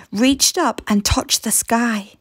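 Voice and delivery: usual speaking voice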